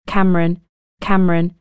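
plain reading voice

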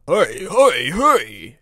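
loud, urgent puffed urging; panting engine. A narrator's reading. Express engine urging the coaches to hurry, loud panting. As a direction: puff it out loud, pushing them on. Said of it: "he panted to the coaches".

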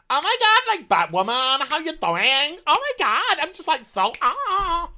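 high, gushing mock valley girl; valley girl parody. Mary fangirling over Batwoman, high gushing parody. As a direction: squeal it like a starstruck teen. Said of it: "she's just a caricature".

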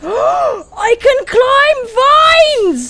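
high, loud slow gasp of realization; gasping discovery. Real, not acted. Bear discovering it can climb, gasping in amazement. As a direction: gasp it like a breakthrough.

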